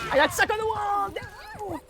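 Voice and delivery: high-pitched cry of dismay; dismayed wail. Real, not acted. Wailing at getting stuck in game.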